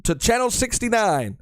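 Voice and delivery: high, slow TV host intro; news host voice. Pretend. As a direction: introduce yourself like an anchor. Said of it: "on today's news show".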